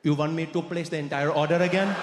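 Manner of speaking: stalling in a fake Indian accent; put-on Indian accent. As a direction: ask reluctantly in an Indian accent. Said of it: "I Trudeau'd too much".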